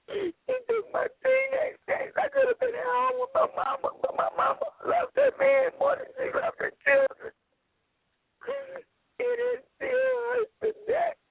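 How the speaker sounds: high, tearful voice cracking into sobs; real crying that breaks through the words; breaking down crying. Real, not acted. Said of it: "She breaking down right now".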